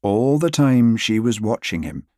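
plain reading voice

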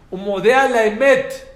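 loud, slow Hebrew declaration; declaimed loud. Real, not acted. Rabbi to audience, loud slow Hebrew quote. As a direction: proclaim it like scripture.

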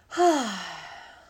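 long loud exhale; heavy sigh. Real, not acted. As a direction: let out a big heavy sigh. Loud sigh after carrying son.

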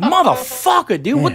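exasperated outburst. Retold or imitated. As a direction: plead with a noisy cat in exasperation. Retold reply to yowling cat.

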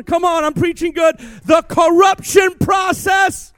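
loud, shouting preacher building; full-force preaching. Acted.

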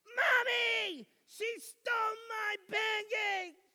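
put-on high, loud child's shout tattling to mom; child shouting for mommy over stolen pancakes; kid yelling. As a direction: yell it like a wronged little kid. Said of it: "I hear my kids yelling".

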